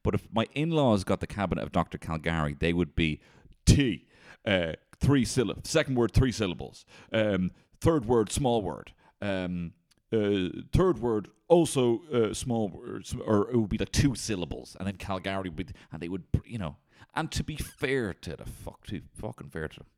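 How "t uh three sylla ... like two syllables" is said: eager, drawn-out clue calling; slow, high clue-giving voice; charades clue giving. Retold or imitated. Acting out charades clues for in-laws.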